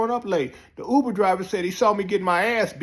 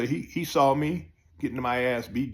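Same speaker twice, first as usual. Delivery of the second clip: ordinary delivery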